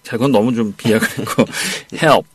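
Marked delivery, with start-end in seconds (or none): 2.0-2.3 "Help" modeled as one syllable; pronunciation demo. Shown as a demonstration. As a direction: model "Help" for the student to repeat. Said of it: "발음이?". ("Help"를 한 음절로 시범 발음)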